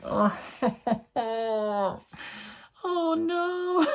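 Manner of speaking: high mock-crying "oh" breaking into laughter; hammed-up weepy squeal; mock tearful wail. Acting out emotional reaction.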